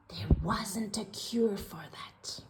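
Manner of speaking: dry whispered punchline. A narrator's reading. Narrator to listeners, whispered aside. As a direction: whisper it like a private joke.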